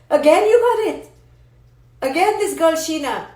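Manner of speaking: loud, high delight; thrilled shout of praise; excited. Real, not acted. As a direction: cheer her on for getting it.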